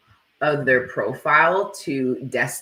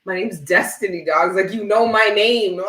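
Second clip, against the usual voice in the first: higher, louder mock-outraged retort; indignant outrage; 7 semitones higher, 6 dB louder than usual. Retelling her outrage at a PayPal under the wrong name, mock indignant. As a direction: bristle at being called the wrong name. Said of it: "I'm like".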